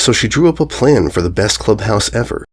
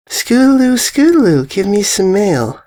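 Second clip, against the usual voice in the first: Derpy calling; 10 semitones higher than usual. Acted. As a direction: call up to the filly in a goofy high voice. Derpy calls to the filly.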